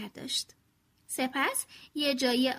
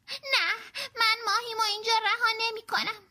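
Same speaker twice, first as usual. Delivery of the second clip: much higher, rushed stubborn refusal; loud refusal; 8 semitones higher than usual. Acted. Franklin refusing his father at dusk, loud refusal. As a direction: refuse to leave, voice up and hurried. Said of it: "فرانکلین بلند گفت".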